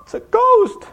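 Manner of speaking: high, loud yell of alarm; hammed-up shriek at a ghost; terrified cry. Preacher voices disciples seeing Jesus on the water, terrified cry. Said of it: "And they cried out".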